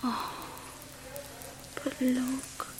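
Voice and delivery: slow, soft, adoring whisper; doting whisper. Acted. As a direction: marvel quietly over the baby. Whispering over the newborn.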